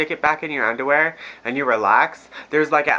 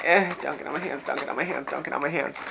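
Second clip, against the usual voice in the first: soft nervous repeated pleading; quietly begging it not to splash; hushed worried chant; anxious muttering; 7 dB quieter, faster than usual. Retold or imitated. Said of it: "I'm like".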